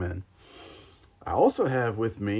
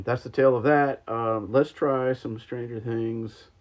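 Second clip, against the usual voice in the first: ordinary talk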